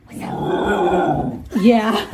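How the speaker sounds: high helpless laugh at the puppy; real laughter. Real, not acted. Laughing hard at her puppy's funny noise.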